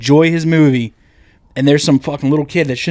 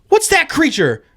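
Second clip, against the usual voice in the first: put-on little kid; 5 semitones higher than usual. Little kid questioning Mom about a monster, imitated kid voice. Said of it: "He's like".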